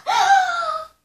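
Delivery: sudden high shriek of fright; panicked scream. Acted. Frightened figure screams at Marcel, high shriek. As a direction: scream as the water rises.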